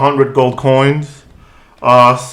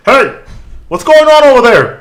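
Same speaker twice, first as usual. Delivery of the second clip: high, loud guard yelling through door; shouting guard; 12 semitones higher, 6 dB louder than usual. Acted. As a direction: call out sharply like a startled guard. GM voices guards behind door.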